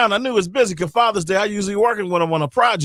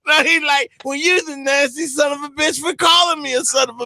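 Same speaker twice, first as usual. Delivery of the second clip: high-pitched laughing retell; real laughter through the story; 10 semitones higher than usual. Real, not acted. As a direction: giggle the quote out.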